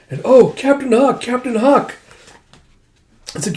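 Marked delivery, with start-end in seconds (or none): 0.3-2.0 high-pitched acted crowd cheer; adoring cheer. Troops hailing their captain, high excited cheer. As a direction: cheer the hero's name in a high voice.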